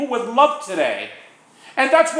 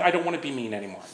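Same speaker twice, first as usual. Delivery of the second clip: quiet plea; 10 semitones lower, 9 dB quieter, much faster than usual. Retold or imitated.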